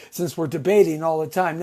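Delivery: usual speaking voice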